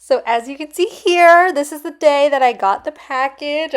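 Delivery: bubbling over with delight; excited. Real, not acted. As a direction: gush about the package arriving. Showing the day the package came.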